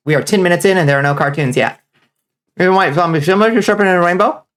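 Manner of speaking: talking while munching popcorn. Real, not acted. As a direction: mouth full of popcorn between words. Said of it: "Sorry, I'm eating a cup of popcorn".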